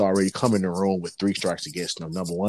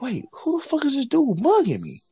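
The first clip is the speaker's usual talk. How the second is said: alarmed disbelief. Retold or imitated. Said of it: "I'm like".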